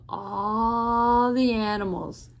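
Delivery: long, stretched "all" in "all the animals"; "all" drawn way out, then "the animals". A narrator's reading. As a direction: hold the word "all" for a storyteller's effect. Storyteller stretches word for effect.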